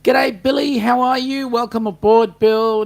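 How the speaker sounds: high, loud, hearty greeting; hearty welcome. Real, not acted. Host welcoming Bill aboard the chat, high hearty greeting. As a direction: hail a newcomer with gusto.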